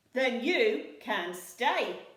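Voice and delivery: loud, very slow decree; pirate captain's verdict. A narrator's reading. Handkerchief accepting the troll, slow and grand. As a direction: pronounce it grandly. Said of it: "said Handkerchief".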